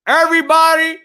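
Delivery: announcer-style hype; loud emphatic repeat. Shown as a demonstration. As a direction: call it out to the crowd. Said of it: "louder second take".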